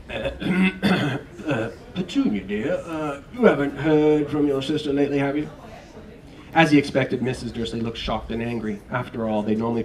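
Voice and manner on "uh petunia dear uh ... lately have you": hesitant, louder nervous husband. Acted. Mister Dursley nervously asking his wife about her sister. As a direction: tiptoe into the question. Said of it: "He cleared his throat nervously".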